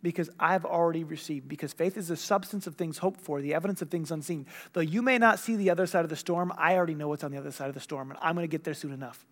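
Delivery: soft, low, assured answer; voicing calm faith amid chaos; at peace. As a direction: explain it quietly, with settled confidence. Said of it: "how do you have peace".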